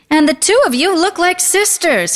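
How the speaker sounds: high, gushing compliment; flattering salesman.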